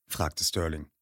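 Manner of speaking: ordinary delivery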